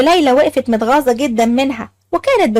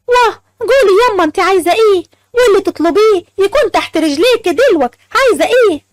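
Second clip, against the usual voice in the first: eager offer; 6 semitones higher than usual. Acted. Asmaa eagerly offering mother.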